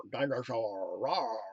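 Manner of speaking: low, drawn-out mock dinosaur; silly dinosaur roar. Fan imitating favorite dinosaur videos, low silly roar. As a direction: growl it low like a pretend dinosaur. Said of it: "I actually prefer the ones that roar".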